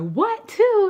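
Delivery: very high, loud what two; shocked coworker. Retold or imitated. Coworker hearing about two boys, high shocked squeal. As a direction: squeal it in disbelief.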